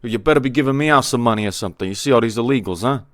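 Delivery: loud, fast mock New York accent; playing a pushy New York local. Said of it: "let me do a New York accent".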